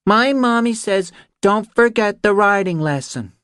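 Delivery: put-on a small girl carefully reciting a memorised message; reciting little girl. Ellie delivers her mother's message to Reacher, high and careful. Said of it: "she recited".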